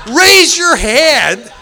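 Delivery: loud, high, very drawn-out order; getting loud. Retold or imitated. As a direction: insist slowly and loudly, stretching each word. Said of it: "he's starting to get loud now".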